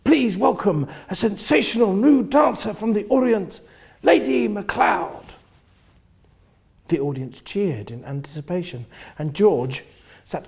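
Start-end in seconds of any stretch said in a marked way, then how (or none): 0.0-5.4 raised, showman's announcing voice; a lightly voiced compere calling out the act; compere's announcement. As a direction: introduce the star like a cabaret host. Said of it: "and announced".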